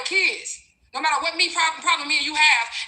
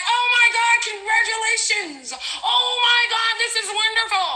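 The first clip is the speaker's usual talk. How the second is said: mock gushing excitement; 7 semitones higher than usual. Mocking the ex's expected joy, hammed-up gushing. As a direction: ham up a squealing fake congratulation. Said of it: "They were expecting me to be like".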